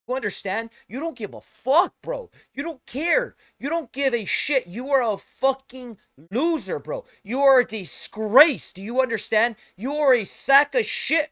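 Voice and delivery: enraged yelling at a streamer; furious shout. Real, not acted. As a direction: scream it right in his face. Screaming rant at Asmongold.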